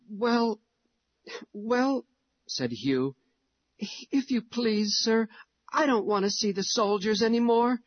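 put-on high, hesitant little boy; a timid boy politely refusing; child voice. Hugh voiced as child again.

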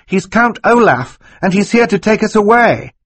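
acted high-voiced girl, insistent; urgent girl's accusation. A narrator's reading. Violet exposes Count Olaf.

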